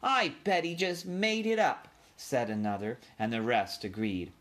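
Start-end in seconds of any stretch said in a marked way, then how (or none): 0.0-1.9 put-on high, accusing child voice; sulky mouse kid, pitched up; sulky cousin. A narrator's reading. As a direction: sneer it like a pouting little mouse. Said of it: "looked disappointed and sulky".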